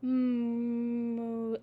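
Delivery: a held, softened "Mm" hum; soft hummed m. Shown as a demonstration. Teacher hums the softened m sound for students. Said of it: "What happens when you soften an M".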